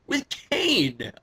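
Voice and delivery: high-pitched disbelief; incredulous. Real, not acted. Wrestling fan baffled by the unlikely partner, incredulous. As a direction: repeat it as if you can't believe it.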